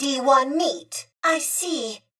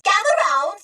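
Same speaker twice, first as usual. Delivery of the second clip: raised, higher call to gather listeners; 6 semitones higher, 3 dB louder, much faster than usual. Acted. Droid storyteller calling the crowd closer, excited. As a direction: beckon everyone over brightly.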